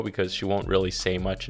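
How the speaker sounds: ordinary talk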